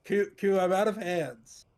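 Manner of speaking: loud complaining. Acted. Bellini complains in character.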